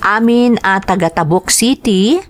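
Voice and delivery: ordinary talk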